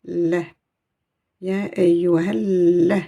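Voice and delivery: la with the doubled l held; stressed doubled l. Shown as a demonstration. Teacher to students, insisting on the shadda. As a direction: press hard on the double l of la. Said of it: "donc je vais insister".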